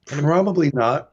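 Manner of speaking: talking while chewing gum; words round a wad of gum. Real, not acted. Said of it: "sorry, I'm chewing gum".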